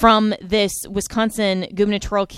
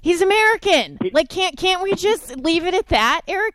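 pitched way up in exasperated disbelief; 9 semitones higher than usual. Real, not acted. Host reacts to the clip in exasperated, high-pitched disbelief.

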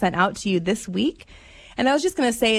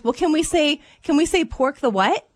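lightly imitated child bargaining, higher pitch; hint of a hopeful kid negotiating; lightly voiced little girl; 7 semitones higher than usual. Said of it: "we have a six-year-old daughter".

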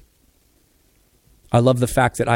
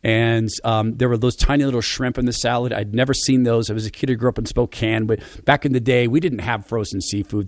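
normal talking voice

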